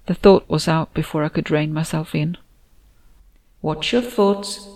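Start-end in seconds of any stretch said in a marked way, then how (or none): 3.6-4.7 slow, stern and measured; stern warning. Acted. Andina warning the narrator about her thoughts, slow and stern. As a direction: warn them slowly, like a strict teacher. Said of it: "came Andina's voice sternly".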